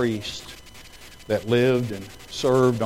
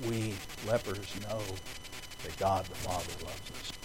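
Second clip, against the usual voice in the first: soft and low, stress on We; quiet voice; 5 semitones lower, 12 dB quieter than usual. Retold or imitated. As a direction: say it quietly, almost to himself. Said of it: "he says in a quiet voice".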